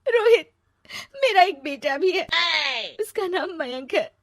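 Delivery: high, loud sob on the name, then tearful; crying. Acted. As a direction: cry out his name and confess. Crying confession of her son. (रोते हुए कबूलना)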